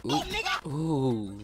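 excited ooh. Real, not acted. Reacting to a kill in game.

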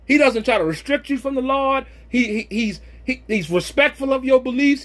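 normal talking voice

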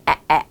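"แอะแอะ" as short open 'ae' sounds; American vowel sound. Shown as a demonstration. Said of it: "เขาจะเสียงแอะแอะนะ". (แอะแอะ เสียงสระแบบอเมริกัน)

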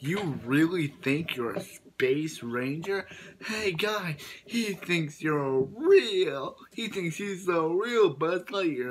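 Woody mocks Buzz, slow sneering taunt; mocking. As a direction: taunt him like a fool. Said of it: "You're mocking me".

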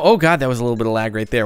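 normal talking voice

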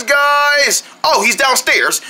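ordinary talk, nothing marked

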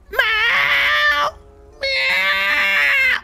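high, drawn-out yowling meow; loud cat meow. Acted. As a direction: yowl like a cat at full voice. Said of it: "They meow".

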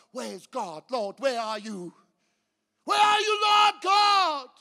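anguished cry. Pretend. Sitting alone wondering where God is, building cry. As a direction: let the plea burst into a cry. Lonely believer crying to God.

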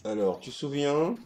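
loud, high, eager calling; excited. Real, not acted. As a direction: call it out, pumped up.

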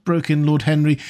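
plain reading voice

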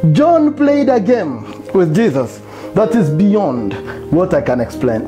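ordinary talk

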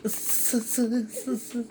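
soft, hissed mimicry of a laugh; mocking imitation. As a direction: mimic the other's snickering under your breath. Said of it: "Seni taklit ediyorum". (alaycı gülme taklidi)

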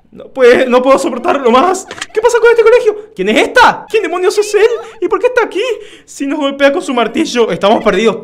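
high, loud panicky student voice, half laughing; scared schoolkid voice. Acted. Gamer voicing a scared student in the horror game, high and panicky. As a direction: read the lines like a frightened kid in a haunted school. Reading game character dialogue aloud.

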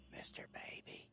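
soft, wary whisper. Acted. Player calling to the baby, cautious whisper. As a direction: whisper it into a room you fear.

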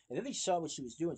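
ordinary delivery, nothing marked

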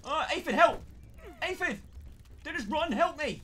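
high, loud frantic pleading; desperate cry for help. Real, not acted. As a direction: beg the aphid for help in panic. Begging aphid for help.

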